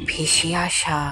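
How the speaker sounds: plain reading voice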